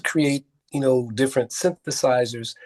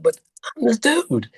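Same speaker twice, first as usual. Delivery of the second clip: high-pitched retold insistence; insistent; 11 semitones higher than usual. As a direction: plead your case, pitched way up. Acted insistence to his friend.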